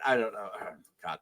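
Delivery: plain reading voice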